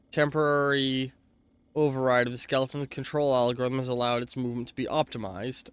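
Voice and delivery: ordinary delivery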